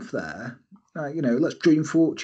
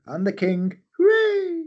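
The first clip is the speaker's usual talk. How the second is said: silly king cheer; 13 semitones higher, slower than usual. Pretend. Cartoon king cheers beside his fearsome wife, squeaky.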